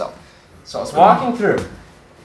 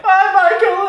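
high singsong taunting goodbye; gleeful farewell call; slower than usual. Retold or imitated. Haunt actor calls after departing visitor, gleeful high taunt. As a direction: send the victim off in a high call.

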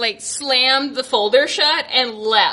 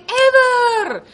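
imitating the nurse; 10 semitones higher, 3 dB louder than usual. Voicing the nurse's warning.

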